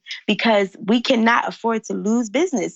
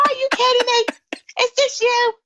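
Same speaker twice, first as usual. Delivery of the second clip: much higher, amazed stranger's voice; astonished stranger. Retold or imitated. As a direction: play the man on the plane, stunned and thrilled. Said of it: "He said".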